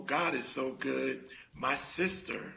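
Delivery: ordinary talk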